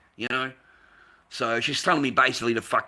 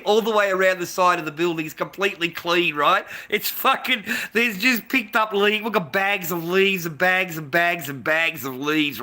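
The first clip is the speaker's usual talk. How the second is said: indignant rant; 5 semitones higher, 4 dB louder than usual. Real, not acted. Indignant rant about leaves.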